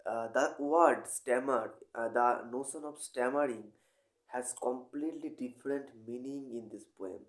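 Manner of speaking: usual speaking voice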